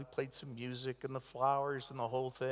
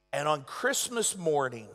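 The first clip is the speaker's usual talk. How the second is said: ordinary talk, nothing marked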